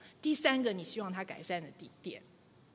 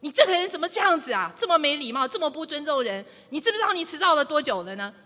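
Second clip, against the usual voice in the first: higher, louder furious scolding; angry outburst; 4 semitones higher, 7 dB louder than usual. Acted. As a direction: blast him for being late. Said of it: "你就说". (怒气冲冲地高声责问)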